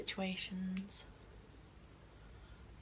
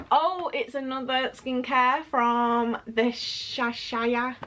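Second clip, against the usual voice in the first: ordinary talk, nothing marked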